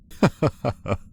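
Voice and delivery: short, high chuckle; quick burst of high laughter; amused laugh. Acted. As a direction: give a brief high laugh, falling away. Amused again at own title.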